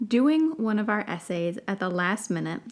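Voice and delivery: usual speaking voice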